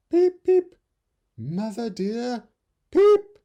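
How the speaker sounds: very high, loud peeping chick; crying baby bird. Acted. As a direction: cry out like a frightened baby bird. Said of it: "he cried".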